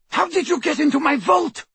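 high screeching demand; furious scream. A narrator's reading. Torturer demanding answers about her vault, furious scream. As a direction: scream the question from a high pitch. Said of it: "they heard Bellatrix scream".

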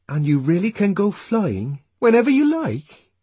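put-on high, eager child's question; wondering little boy. Boy asking his mother about the other place, high and wondering. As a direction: ask in a child's voice, full of wonder.

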